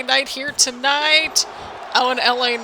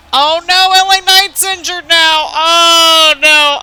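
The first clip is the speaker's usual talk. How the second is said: high, loud cry of dismay; louder, higher oh no; alarmed shout; 5 semitones higher, 10 dB louder, slower than usual. Real, not acted. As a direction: call it out in real alarm. Wrestler injured after the match.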